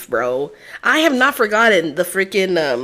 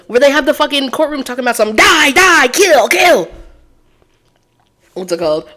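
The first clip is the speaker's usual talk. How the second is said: ordinary delivery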